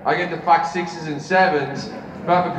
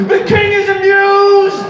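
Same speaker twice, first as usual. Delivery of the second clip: high, loud, drawn-out proclamation; court jester; 9 dB louder, slower than usual. Acted. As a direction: shout it like a jester announcing to court. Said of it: "then the court jester went".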